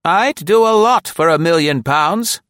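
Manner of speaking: high, loud and tart; high put-on voice dripping acid. A narrator's reading. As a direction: needle him with a sour remark. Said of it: "Nora observed acidly".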